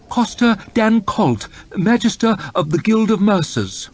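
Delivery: high, hesitant stammering aide; nervous secretary. Acted. Secretary nervously pleading the farmer's case to his lord. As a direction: tread carefully, unsure. Morrow announces the merchant.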